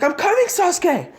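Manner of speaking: high, loud cry for a teammate; desperate shout. Acted. Naruto calling to Sasuke while racing back, high shout. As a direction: yell it as you rush back. Hero races back to team.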